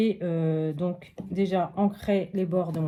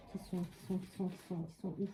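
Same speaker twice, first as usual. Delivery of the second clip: muttering to herself; 11 dB quieter, faster than usual. Real, not acted. Searching for rhinestones, to herself.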